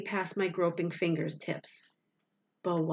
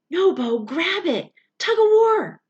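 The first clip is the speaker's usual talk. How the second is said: much higher, louder panicked scream; 12 semitones higher, 11 dB louder than usual. A narrator's reading. Boy screaming at his dog to grab the rope. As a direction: scream as if the dog is slipping away. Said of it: "My voice was high and panicked".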